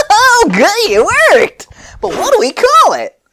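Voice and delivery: squeaky high, loud delight; high giddy Fu celebrating; high excited Fu voice. Acted. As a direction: pitch it way up like a thrilled inventor. Fu voiced in the story.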